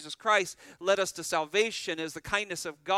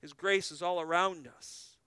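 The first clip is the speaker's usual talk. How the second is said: ordinary talk, nothing marked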